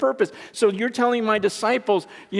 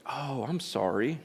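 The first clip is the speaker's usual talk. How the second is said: softer, slow sheepish sorry; a hint of a sheepish Jesus apologising; mock meek apology; 5 semitones lower, 7 dB quieter, slower than usual. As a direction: play a timid apology for a laugh. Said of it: "No, that's not what he says".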